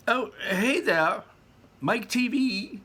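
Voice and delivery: very high, slow loud call; shouted. A narrator's reading. Wonka shouts at Mike, very high and slow. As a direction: call out across the boat. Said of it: "shouted Mister Wonka".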